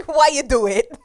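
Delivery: put-on laughing echo of a New York accent; mimicked New York accent. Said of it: "doing the New York accent".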